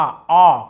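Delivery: आ rounded as "aw", held long. Shown as a demonstration. Teacher showing the AU sound, slow long "aw" for आ. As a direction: draw out आ as an open "aw". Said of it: "थोड़ा लंबा".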